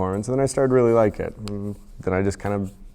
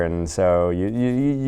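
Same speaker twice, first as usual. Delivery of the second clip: ordinary delivery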